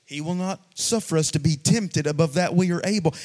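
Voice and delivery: usual speaking voice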